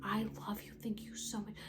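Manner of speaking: soft unvoiced whisper of thanks; hushed grateful whisper. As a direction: breathe the words, no voice.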